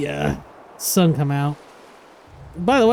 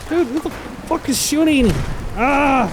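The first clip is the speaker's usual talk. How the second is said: startled shout; 7 semitones higher than usual. Real, not acted. Shot at by unseen enemy.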